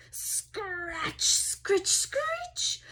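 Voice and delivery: rising high scratchy noises; scratching noises. Acted. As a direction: make the window scrape creepy. Imitating scratching sounds.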